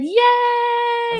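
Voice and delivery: high squealed yay; excited cheer. Retold or imitated. Reading listeners' comments, a high excited cheer. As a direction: cheer it like a happy fan. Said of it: "Brittany and Tina both said".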